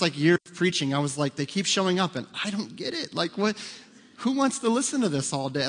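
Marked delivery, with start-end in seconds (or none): 1.4-5.7 high-pitched mock disbelief; retold bafflement. Preacher retelling what he told his wife about returning churchgoers, baffled. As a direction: pitch it up, baffled by your own audience. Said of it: "I told Sandra after the first".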